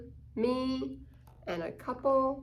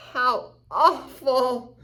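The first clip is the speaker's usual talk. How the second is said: higher, louder, slow theatrical despair; hammed-up gloom; 3 semitones higher, 8 dB louder, slower than usual. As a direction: wail it like the world is ending. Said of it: "Pessimistic means".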